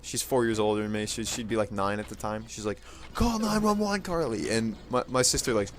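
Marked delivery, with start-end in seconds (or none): 3.1-4.5 high-pitched imitation of a panicked yell; mock yell. Mom ordering sister to call for help, mock yell. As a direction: call out high, holding back the volume. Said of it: "she starts yelling at the house".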